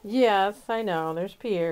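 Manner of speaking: normal talking voice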